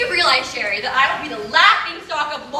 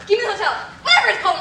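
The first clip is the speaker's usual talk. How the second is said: normal talking voice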